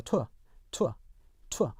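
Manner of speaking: British tour as "too-uh", no R; British non-rhotic. Shown as a demonstration. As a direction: glide tour into a schwa, British style. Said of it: "영국 표준 영어에서는요".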